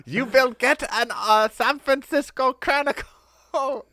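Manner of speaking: high, loud, hammed-up Cockney; bad Cockney accent. An American faking Cockney to tell Martha to get a paper. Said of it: "an American doing a Cockney accent".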